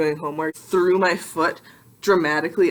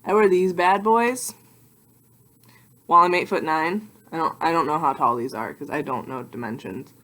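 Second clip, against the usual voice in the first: usual speaking voice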